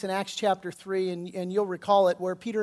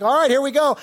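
louder, higher mock-preacher launch; typical preacher; 6 semitones higher, 9 dB louder than usual. Peter gearing up before a captive audience, eager preacher. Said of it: "typical preacher. He's got a crowd".

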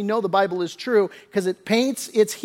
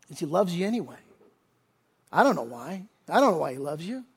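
hushed wonder; 9 dB quieter, faster than usual. Real, not acted. Preacher admits he can't explain God's love, in hushed wonder. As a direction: drop to a quiet, heartfelt confession.

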